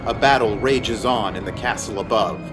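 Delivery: usual speaking voice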